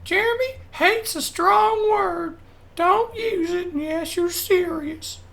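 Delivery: very high, slow mock-motherly scolding; high-pitched mom impression, drawn out and slow; imitating mom. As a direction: do your mom lecturing you in a squeaky high voice. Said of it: "My mom always made me say that".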